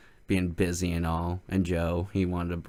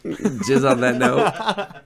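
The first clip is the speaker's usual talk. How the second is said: loud, high, drawn out with laughter; laughing; 7 semitones higher, 8 dB louder, much slower than usual. Real, not acted. Host realizing the Freudian slip, laughing hard. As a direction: repeat it while cracking up.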